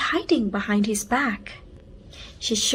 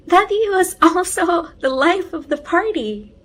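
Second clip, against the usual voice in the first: giggling; 7 semitones higher, 7 dB louder than usual. A narrator's reading. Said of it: "Hafsa giggled".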